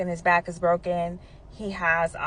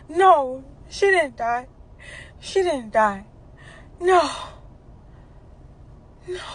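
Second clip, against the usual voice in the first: higher, insistent denial; stepfather in denial; 6 semitones higher, slower than usual. Retold or imitated. Stepfather insisting to Dana her mother didn't die, high denial. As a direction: refuse to accept it. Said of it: "This is what he says to me".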